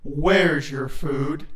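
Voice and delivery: low, slow creature voice; deep, stern demand. A narrator's reading. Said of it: "said in a stern tone".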